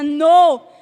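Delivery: loud, blunt contradiction; negativa de bruja. Retold or imitated. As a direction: imitate the witch's forceful no. La bruja le contesta que no. (negativa fuerte de la bruja)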